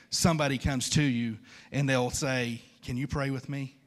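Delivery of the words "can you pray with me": ordinary talk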